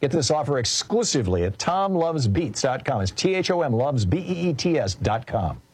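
upbeat radio ad read with lively, high-swinging pitch; animated sponsor spot. Acted. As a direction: sell it like a keen personal endorsement. Host reads a supplement ad.

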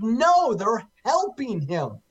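emphatic, high-pitched "No", settling as it goes on; mock outcry, starting high and easing off; retold scream. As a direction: protest it as a retold outburst. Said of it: "And I screamed, I said".

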